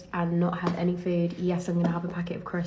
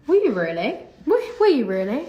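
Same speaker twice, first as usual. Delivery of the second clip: loud, high-pitched disbelieving question; mock incredulous; 6 semitones higher, 7 dB louder than usual. Customer doubting she was born in England, mock incredulous. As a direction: imitate someone who flatly doubts her answer.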